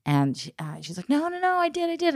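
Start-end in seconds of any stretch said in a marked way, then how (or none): 1.1-2.2 put-on whiny teen denial. Daughter insisting to mom.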